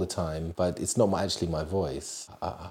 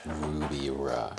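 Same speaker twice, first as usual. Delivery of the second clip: slow, low and soft name reveal; drawn-out name; 5 semitones lower, 6 dB quieter, much slower than usual. Acted. Savouring the product name.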